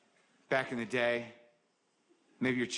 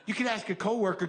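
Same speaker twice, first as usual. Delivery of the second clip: ordinary talk, nothing marked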